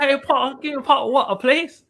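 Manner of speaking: high, loud hammed-up British voice; mock posh British. Host teasing the guest, in a hammed-up British voice. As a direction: camp it up like a Harry Potter character.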